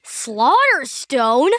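slow, drawn-out questioning echo; intrigued disbelief. Acted. Boy hears a gruesome stone name, intrigued echo. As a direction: savor the grisly name as a question.

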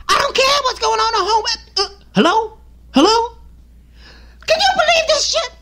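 high, put-on voice of an outraged guy answering his phone; rude moviegoer on the phone. Man in the theater to his girlfriend on the phone, high indignant put-on voice. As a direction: play the loudmouth taking a call mid-movie, annoyed and indignant.

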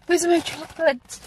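gasp, then a high panicked question; dramatic gasp of disbelief; gasping alarm. Real, not acted. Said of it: "what a dramatic intro".

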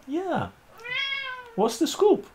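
high sing-song pet voice. Real, not acted. Owner asking the cat for news in high baby talk.

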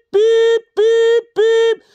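high, loud sustained beeps; censor beep. Pretend. Bleeping out swear words in mock outrage.

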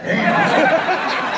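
high laughing exclamation. Real, not acted. Joking about his chest tattoo on set, laughing. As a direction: burst out amused.

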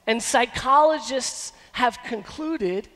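normal talking voice

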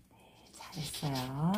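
very soft, fast murmur of praise; soft quick praise. Real, not acted. Teacher softly praising the student's reading. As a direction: murmur a quick well done.

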